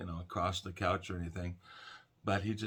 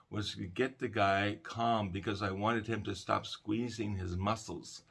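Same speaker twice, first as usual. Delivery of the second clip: ordinary talk, nothing marked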